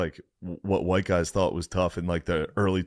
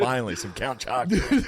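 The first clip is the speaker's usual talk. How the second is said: laughing mock; 5 semitones higher than usual. Mocking fighter eating cereal.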